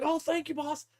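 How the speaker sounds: very high little minion voice; imitated tiny grot; grateful grot. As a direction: gush thanks in a squeaky voice. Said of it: "he's like".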